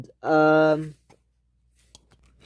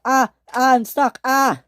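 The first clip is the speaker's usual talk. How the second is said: high, loud yelps of alarm; acted panic in bursts; panicked cries; 9 semitones higher, 5 dB louder than usual. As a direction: cry out that you are trapped. Engine stuck, crying out.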